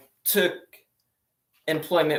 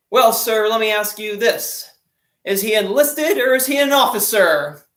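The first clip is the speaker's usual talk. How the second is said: higher and louder, cheeky punchline; a young sailor answering the admiral, louder with a cheeky twist; smart-aleck seaman; 6 semitones higher, 6 dB louder than usual. Retold or imitated. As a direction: deliver the comeback with bold cheek.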